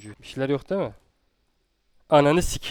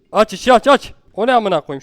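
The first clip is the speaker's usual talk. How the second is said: higher, louder frantic orders; panicked shouting; 6 semitones higher, 10 dB louder than usual. Real, not acted. Gamer shouting at his character, panicked. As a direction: yell it in a panic. Monster appears in game.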